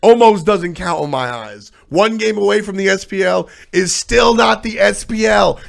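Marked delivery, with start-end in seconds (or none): 3.2-5.6 slow, louder shout; emphatic shout. Real, not acted. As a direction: hammer it home slowly. Ranting about almost making it.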